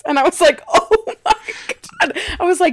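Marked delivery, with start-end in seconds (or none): 0.7-1.3 loud, high laughing outburst; laughing exclaim. Retold or imitated. To her little brother, laughing loud and high. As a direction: burst out laughing in delighted shock. Brother offers to reveal gift.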